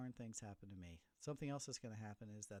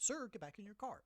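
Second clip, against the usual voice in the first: a raised, barking order from a staff member; mock yell; 9 semitones higher, 5 dB louder than usual. School staff yelling at a parent, raised mock yell. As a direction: shout him back into his car. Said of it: "they get yelled at".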